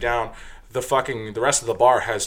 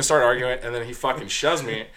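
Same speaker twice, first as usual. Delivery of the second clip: ordinary talk, nothing marked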